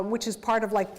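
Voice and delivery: usual speaking voice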